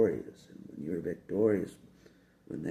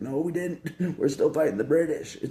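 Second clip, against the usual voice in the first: high, loud put-on voice of old Americans; mock colonists; 10 semitones higher, 8 dB louder than usual. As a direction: play the indignant patriots shouting back, much higher. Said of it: "They're like".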